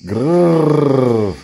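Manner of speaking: a small beast's grrr; baby growl. A narrator's reading. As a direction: growl it, rough and rumbling. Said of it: "εξακολουθούσε να γρυλίζει".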